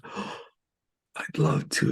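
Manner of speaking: hushed, whispery imitated reply; breathless acceptance. Guitarist accepting the tour invitation, breathless. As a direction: gasp it softly, as if overcome. Acts out friend's delighted acceptance.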